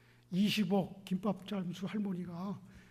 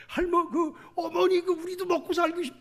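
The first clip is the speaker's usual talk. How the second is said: imitating the children; 10 semitones higher, 5 dB louder than usual. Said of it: "자식들이 반대를 그렇게 했대요".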